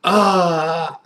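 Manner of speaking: long drawn-out sigh of pleasure; satisfied "ah". Real, not acted. Reviewer smelling a good wine, long satisfied ah. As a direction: let out a long, savouring "ahh". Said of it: "it makes you go, "Ah".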